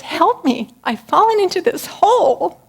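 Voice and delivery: high, loud plea with a laugh in it; calling for help. Acted. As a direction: shout up to a friend, half laughing. Man calls to friend.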